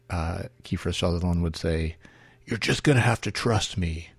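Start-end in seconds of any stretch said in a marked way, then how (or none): 2.5-4.1 overacted husky hero line, slightly higher; gruff dramatic TV hero delivery; exaggerated husky voice. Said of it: "this kind of exaggerated husky voice".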